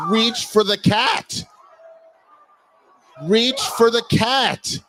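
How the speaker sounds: high, loud, slow urging at the screen. Real, not acted. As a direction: yell at the rescuer to grab it.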